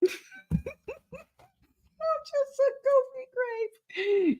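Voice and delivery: very high, slow squeal through laughter; helpless laughter. Real, not acted. Tired host calls herself goofy, squeaking with laughter. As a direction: wheeze it out while cracking up.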